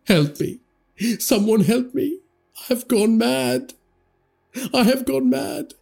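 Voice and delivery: high, desperate wail for help; rising panicked wail. A narrator's reading. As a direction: cry out like a man losing his mind. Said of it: "and wailed".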